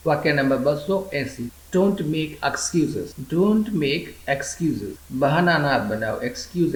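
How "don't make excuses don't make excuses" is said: very slow, careful teacher read; slow model sentence. Shown as a demonstration. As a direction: lay each word out slowly for learners. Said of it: "teacher models English sentence twice". (ધીમે ધીમે સ્પષ્ટ ઉચ્ચાર)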